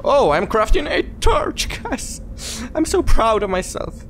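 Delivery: high-pitched, giggly bragging; excited pride. Real, not acted. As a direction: squeal with pride to your viewers. Celebrates crafting a torch.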